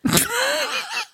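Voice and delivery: a sudden squealing laugh; high laugh. Real, not acted. Laughing at her own fear of being shot, shrieking high.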